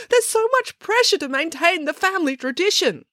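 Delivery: put-on very high wailing girl's voice. A narrator's reading. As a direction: wail each line, pitched high. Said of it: "wailed Matilda".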